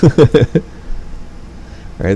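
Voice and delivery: normal talking voice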